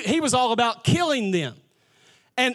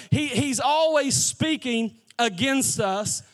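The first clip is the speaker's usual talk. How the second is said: ordinary talk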